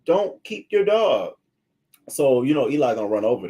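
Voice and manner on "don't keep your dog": higher and slow, baffled and fed up; exasperated disbelief. Retold or imitated. Venting to herself about a careless dog owner, slow incredulous disbelief. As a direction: drag it out like you can't believe what you're seeing. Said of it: "I'm just saying to myself".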